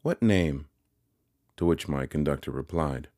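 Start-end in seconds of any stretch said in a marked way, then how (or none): 0.0-0.7 crisp demand, a little raised; clear demand. A narrator's reading. Young lady at the window to callers, demanding. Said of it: "a clear voice demanded".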